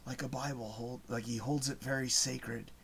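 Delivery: soft, low, hushed movie quote. Retold or imitated. Imitating the guy in the movie, very low and hushed. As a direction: drop right down, almost to a whisper. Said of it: "says it very low, s-, low voice".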